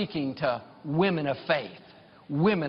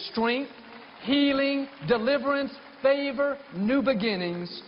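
preaching declaration; 9 semitones higher than usual. Acted. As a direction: proclaim each blessing up high.

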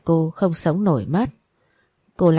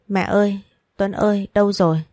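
lightly raised call searching the house; calling out loud; 2 semitones higher than usual. A narrator's reading. Daughter searching the empty house, calling out mildly raised. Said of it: "gọi lớn".

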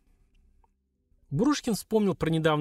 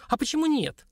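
high-pitched defiant exclamation; fiery challenge, pitch leaping up; hot-headed; 13 semitones higher than usual. A narrator's reading. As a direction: flare up, taking offence. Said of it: "запальчиво воскликнула Лилька".

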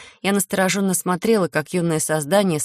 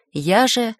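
ordinary delivery, nothing marked

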